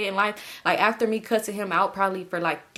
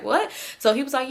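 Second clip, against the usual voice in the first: ordinary delivery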